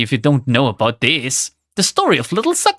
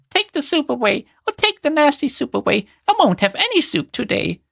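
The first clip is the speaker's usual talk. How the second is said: put-on a sulky boy squealing at the table; mock scream; 8 semitones higher than usual. A narrator's reading. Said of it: "he screamed out".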